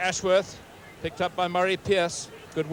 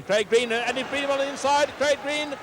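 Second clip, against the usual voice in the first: rising higher with each name; mounting excitement calling the runner; excited call; 10 semitones higher than usual. Real, not acted. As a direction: build as the winger runs. Winger breaking on the wing.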